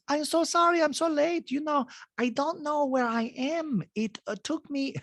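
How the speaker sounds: bad Diana impression. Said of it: "this is the worst Diana Jane".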